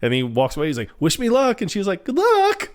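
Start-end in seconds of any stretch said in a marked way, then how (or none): 1.0-1.6 high-pitched mock call over the shoulder; excited send-off. Imitates Phil calling while leaving. 2.0-2.7 very high mock squeal; hammed-up girlish cheer; giddy well-wishing. Imitates Nancy's giddy reply.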